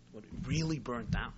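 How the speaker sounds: low, hushed doubting question; quietly worried. Retold or imitated. Miser privately checking with his manager, quiet worry. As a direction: ask it under the breath, acting uneasy. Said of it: "a tinge of worry creeps into his heart".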